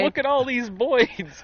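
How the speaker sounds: put-on slow, high, loud Brooklyn accent. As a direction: ham up a New York "Boids". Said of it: "I don't know what a Brooklyn accent is".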